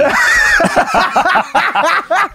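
shrieking burst of laughter; loud high laugh. Real, not acted. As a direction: let out a high whooping laugh. Big laugh at the joke.

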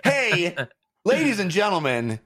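big announcer energy with high-pitched peaks; excited podcast opener; hyped show intro. Acted. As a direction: sell it like a ringside host, stretching key words.

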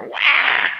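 put-on sudden high breathy baby wail. Imitating a baby crying at the visiting holy man, high breathy wail. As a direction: squall like a startled infant.

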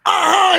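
high, loud drawn-out holler; mock excited holler. Acts out approaching the tall man.

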